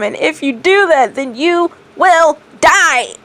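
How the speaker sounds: high loud desperate demon; shouting. A narrator's reading. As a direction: yell the warning frantically. Demon screams warning at Gaara.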